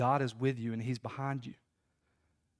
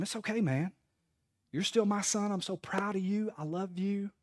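tender, higher-pitched consoling voice; comforting dad; 6 semitones higher than usual. Retold or imitated. Dad with arm round his son after a strikeout, comforting. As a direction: reassure a crestfallen child, gently. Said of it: "I'd put my arm around him".

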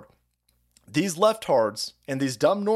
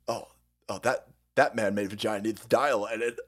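usual speaking voice

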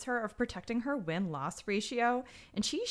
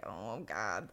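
low dismayed murmur; 11 semitones lower, 8 dB quieter than usual. Retold or imitated. Retold watcher's dismayed remark.

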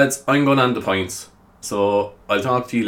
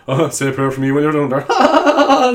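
higher, laughing customer quip; jokey customer; 5 semitones higher, 5 dB louder than usual. Retold or imitated. Customer joking at the kneeling shop worker, laughing and louder. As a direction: tease the shelf stacker, laughing. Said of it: "shop customer joke".

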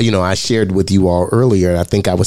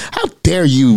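retold anger; 6 semitones higher than usual. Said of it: "I was angry".

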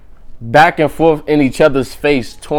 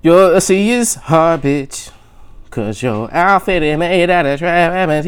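mock roast; 5 semitones higher, 3 dB louder, slower than usual. Repeating the show's roast line.

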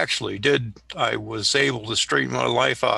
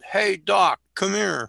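higher-pitched retold call; John Wayne yelling out; 7 semitones higher, 3 dB louder than usual. John Wayne hailing a Navy medic in the drugstore, calling out. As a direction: call out to get someone's attention. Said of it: "I hear this guy yell out".

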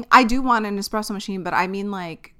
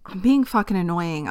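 normal talking voice

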